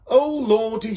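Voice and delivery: high, loud exclamation; dismayed donkey man. A narrator's reading. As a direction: throw up his hands. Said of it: "said the donkey man".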